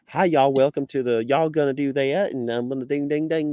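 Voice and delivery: hammed-up Virginia accent. Imitating city locals welcoming people, mock Virginia accent. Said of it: "the Virginia accent is, um, is strong".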